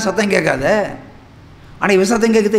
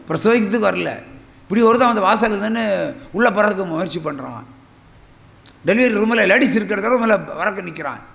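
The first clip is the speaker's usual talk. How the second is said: ordinary talk, nothing marked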